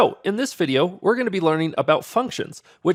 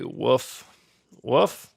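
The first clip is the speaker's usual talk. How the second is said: a weak, lazy bark that barely tries; horrible dog impression; 3 semitones lower than usual. Shown as a demonstration. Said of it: "That was a horrible dog impression".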